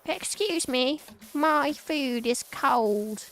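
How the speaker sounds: high, slow, whiny complaint; whiny passenger. Pretend. Passenger complains to the rat in a high whine. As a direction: squeak it like a fussy diner. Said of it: "then it's like".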